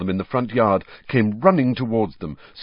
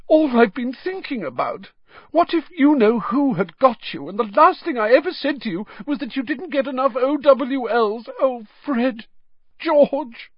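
sobbing mother; 4 dB louder than usual. Acted. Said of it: "starting to sob".

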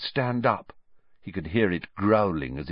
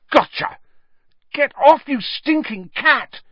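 much higher, louder, furious; angry shout; 6 dB louder than usual. A narrator's reading. Ron fending off the cat, angry shout. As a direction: bark at the cat.

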